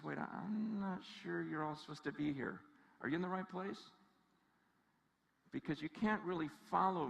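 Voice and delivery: hushed, low-pitched doubt; skeptical. Acted. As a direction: question them quietly, as if they came to the wrong place. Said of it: "He gets skeptical".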